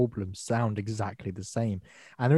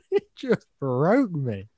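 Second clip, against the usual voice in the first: ordinary delivery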